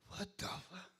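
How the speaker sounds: hushed whisper of disbelief. Real, not acted. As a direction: whisper it in confusion. Whispers in confusion.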